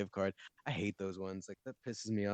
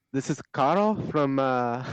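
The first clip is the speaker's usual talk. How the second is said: higher, slow, hammed-up cheesy phone caller; mock caller voice; 4 semitones higher, 5 dB louder, slower than usual. Friend imitating the scam caller Carl, hammed-up and slow. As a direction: lay it on like a scripted telemarketer.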